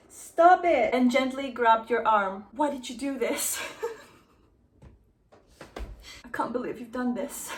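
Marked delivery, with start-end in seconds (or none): 0.1-0.9 cracking up mid-shout, much louder and higher; loud laugh-yell while reading. Real, not acted. Laughing while reading fanfic aloud. 2.5-7.5 laughing through it. Real, not acted. Laughing through fanfic lines.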